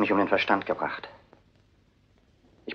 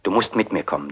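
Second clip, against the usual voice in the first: ordinary talk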